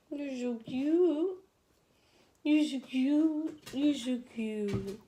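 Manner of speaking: high, slow drawn-out coo; slow sugary pet talk. Real, not acted. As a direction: melt over the dog, stretching every word. Cooing over the dog.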